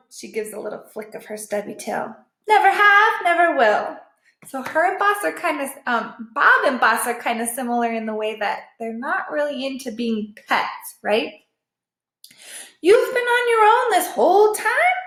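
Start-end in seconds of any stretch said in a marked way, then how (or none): 2.5-4.0 loud high flat refusal; proud stray, no owners ever; higher and louder firm denial; defiant. A narrator's reading. Boss voiced in story. 12.8-15.0 higher, louder shocked ask; lightly voiced disbelief; amazed question. Shown as a demonstration. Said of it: "let me repeat".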